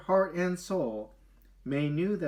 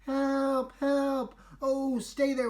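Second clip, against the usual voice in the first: calling for help; 11 semitones higher, much slower than usual. Acted. Stranded animals calling out.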